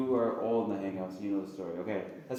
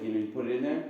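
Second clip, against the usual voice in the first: usual speaking voice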